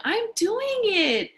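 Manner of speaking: put-on a narrated girl's thrilled cry; excited girl. A narrator's reading. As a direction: let the child's delight ring out. Girl succeeding on bike.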